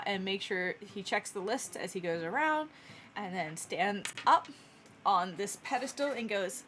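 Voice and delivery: ordinary talk, nothing marked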